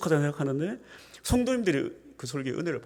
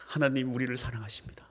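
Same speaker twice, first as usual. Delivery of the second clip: whispered practice; 3 semitones higher than usual. Shown as a demonstration. Pastor practicing complete sentences, whispered. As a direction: whisper full sentences in standard speech. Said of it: "연습하고, 또 연습하고".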